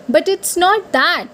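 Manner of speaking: ordinary talk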